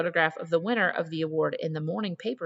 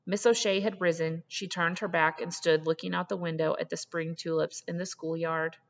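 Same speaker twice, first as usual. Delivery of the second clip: usual speaking voice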